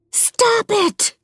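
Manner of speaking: high, desperate shouted plea; frightened mother screaming at the intruder; shouting and sobbing. Acted. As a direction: scream it through tears. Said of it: "Hannah is shouting and sobbing".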